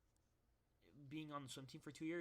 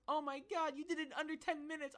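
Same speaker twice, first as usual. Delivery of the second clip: high, fast, louder doting mom voice; gushing mom, pitched way up; excited mom; 13 semitones higher, 6 dB louder than usual. Retold or imitated. Mom cheering his swim time.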